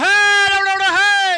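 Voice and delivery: shouted high protest, like villagers stopping an arrest; imitating a crowd shouting. Preacher voicing angry villagers at the film, high yell. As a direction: yell it out in alarm, far above the normal voice. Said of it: "they start jumping up and like".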